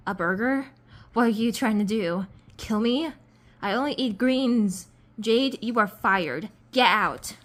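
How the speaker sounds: loud, outraged boss dismissing an assistant; angry boss. Acted. Boss rejects the burger and fires Jade, angry. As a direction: punch it out loud, slowing on the firing. Boss acted out firing the narrator.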